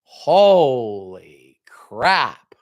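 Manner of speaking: very slow, drawn-out mock dismay; holy crap. Have-not reacting to advice, drawn-out mock shock. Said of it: "It's like".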